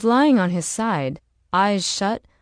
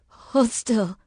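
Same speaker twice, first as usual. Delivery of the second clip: strained, higher-pitched groan; groaned plea; 4 semitones higher than usual. Acted. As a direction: groan it out through exhaustion. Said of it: "she groaned".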